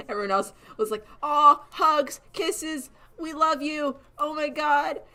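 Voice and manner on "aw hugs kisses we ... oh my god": higher, gushing mock-sweet voice; syrupy sing-song sarcastic gush; overly happy. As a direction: parody the saccharine classmates. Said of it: "All overly happy".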